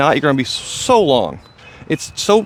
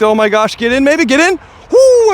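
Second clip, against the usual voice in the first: higher, louder, willing it in; excited urging; 10 semitones higher, 5 dB louder than usual. Real, not acted. Urging his putt toward the basket, excited. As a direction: yelp as the disc nearly drops.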